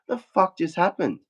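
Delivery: mock disbelief at what just happened; retold bewilderment. Baffled after losing his licence.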